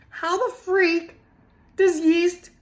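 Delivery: ordinary talk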